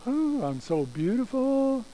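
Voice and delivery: high, slow, drawn-out cooing; self-admiring little girl. Acted. Susie admiring herself in the car mirror, self-admiring coo. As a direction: swoon over your own reflection.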